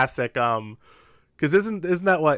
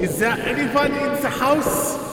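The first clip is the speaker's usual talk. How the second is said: calling down a hallway; 8 semitones higher than usual. Acted. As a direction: announce yourself to the house. Formal call into the house.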